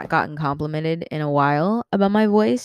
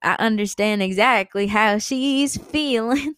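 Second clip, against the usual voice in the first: higher, rising gushy voice; gushing imagined listener; 5 semitones higher than usual. Acted. Imagined listeners relating to this girl, gushing. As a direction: climb higher as you gush in sympathy. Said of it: "they'd be like".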